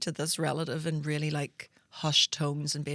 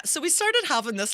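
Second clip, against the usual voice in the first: ordinary talk, nothing marked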